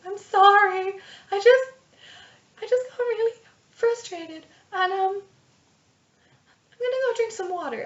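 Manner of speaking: very high, upset apology; flustered, high-pitched and hesitant; timid healer. Acted. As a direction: apologise in a high, faltering little voice. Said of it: "that's the way she talks".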